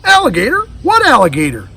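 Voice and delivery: high, loud startled question; mother caught off guard; alarmed high rise on Alligator; surprised mother. Acted.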